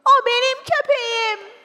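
high, loud, excited cry; proud shout. Acted. Child claiming the dancing dog before everyone, shouting. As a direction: yell it proudly across the hall. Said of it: "diye bağırdım".

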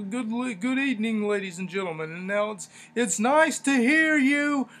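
mock commentator shouting over music; Michael Cole screaming. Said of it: "it sounds like Cole is screaming".

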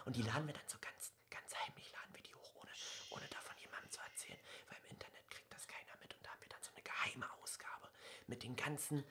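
hushed conspiratorial whisper. Pretend. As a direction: whisper it like a secret. Secret upload plan, whispered. (geheimnisvoll flüsternd)

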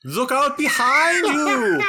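loud, slow, high warning cry; alarm shout. Acted. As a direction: shout a warning across the street. Warning companion of attack.

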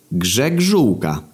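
"Grzegżółka" drawn out slowly, falling at the end; slow repeat. Acted. Sebastian repeating his surname after "jak?", slow and stretched. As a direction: stretch each syllable of "Grzegżółka" for the listener.

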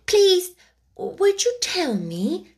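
put-on high, hesitant young girl; timid girl. A narrator's reading. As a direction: ask shyly, unsure of manners. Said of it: "said Alice a little timidly".

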